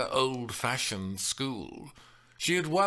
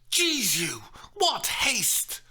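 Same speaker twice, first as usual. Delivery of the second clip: high, loud bitter curse; bitter oath; 14 semitones higher, 5 dB louder, slower than usual. Acted. The nurse cursing Juliet's haste. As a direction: swear it out bitterly. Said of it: "making it sound like a bitter oath".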